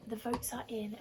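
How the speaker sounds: plain reading voice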